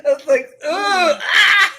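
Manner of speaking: loud, high, slow laughing groan; disgusted laugh at the story. Real, not acted. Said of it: "disgusted laughing".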